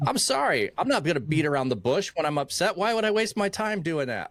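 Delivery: high-pitched, quick retold protest; mock indignant comeback. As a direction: argue back in exaggerated disbelief. Acts out his defiant comeback.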